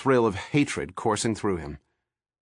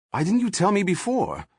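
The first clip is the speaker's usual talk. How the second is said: indignant question; 11 semitones higher than usual. A narrator's reading. D'Agosta annoyed at Pendergast.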